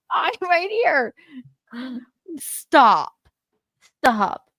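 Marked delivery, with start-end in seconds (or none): 0.1-1.1 a touch higher, lightly mocking repeat; lighter mimic. Daughter repeats Zoe's line, lightly mocking. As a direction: toss the line off as a smaller echo.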